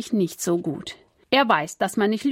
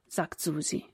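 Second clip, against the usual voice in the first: ordinary delivery, nothing marked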